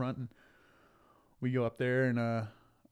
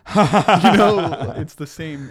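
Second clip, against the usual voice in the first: much higher and louder, through real laughter; laughing; 8 semitones higher, 21 dB louder, much slower than usual. Real, not acted. Host cracking up at his grandma joke, laughing aloud. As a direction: break up laughing mid-sentence.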